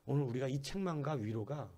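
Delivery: usual speaking voice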